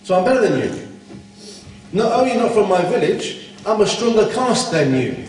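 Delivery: ordinary talk, nothing marked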